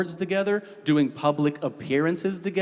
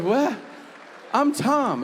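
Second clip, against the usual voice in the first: high, innocent mock denial; playing dumb; 11 semitones higher, slower than usual. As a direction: feign confusion, voice pitched up. Denying being Jermaine.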